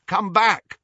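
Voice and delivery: high, loud call in a cracked voice. A narrator's reading. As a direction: call out sharply, voice cracked from lack of use. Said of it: "his voice was cracked with lack of use".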